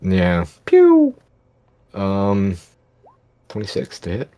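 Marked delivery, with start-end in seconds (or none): 0.7-1.1 very high, loud "pew"; loud shooting sound effect; mimicked blaster shot. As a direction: fire off a piercing vocal laser zap. Player mimics the Eldritch Blast shot.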